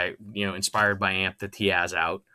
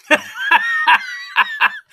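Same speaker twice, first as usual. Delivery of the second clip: high laugh; 9 dB louder than usual. Real, not acted. Laughing off pranks question.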